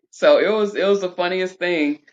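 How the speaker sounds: ordinary talk, nothing marked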